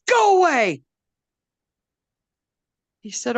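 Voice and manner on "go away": much higher, loud snarl; grumpy tree. A narrator's reading. Blackberry telling Wolf to leave, loud high snarl. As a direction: shove him off with a bark. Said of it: "And Blackberry said".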